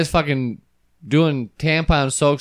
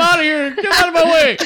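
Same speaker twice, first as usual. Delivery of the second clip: laughing; 13 semitones higher than usual. Pretend. Laughing mock exit line.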